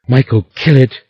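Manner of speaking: frantic scream at her husband. A narrator's reading. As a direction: scream the command in terror. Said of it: "screamed Rowan".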